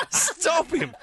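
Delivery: mock alarm. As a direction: call out in mock panic. Mock alarm at new Pope.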